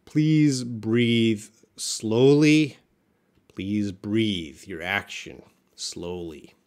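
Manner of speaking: very slow, spaced-out words; deliberate, drawn-out coaching; slow instruction. Shown as a demonstration. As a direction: stretch each word as if pacing someone's breathing. Teacher models a calming instruction.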